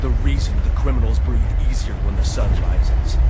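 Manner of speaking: defiant yelling that builds to its peak; angry shout. Acted. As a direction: hammer each claim home, furious. Bruce shouts down his butler.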